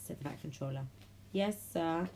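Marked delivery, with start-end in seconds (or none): none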